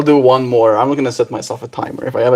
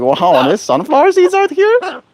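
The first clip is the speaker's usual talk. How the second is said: high, slow, amazed bird voice; voicing the bird; slower than usual. Owner voicing her bird's delight at the seeds, high and slow. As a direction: squeak out the bird's wonder slowly. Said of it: "You're like".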